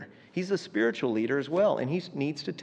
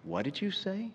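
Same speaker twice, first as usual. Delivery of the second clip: soft, higher-pitched fiancée voice; mock tender; 5 semitones higher, 9 dB quieter than usual. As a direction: ask it ever so sweetly, hushed and lifted. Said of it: "ever so tenderly and said".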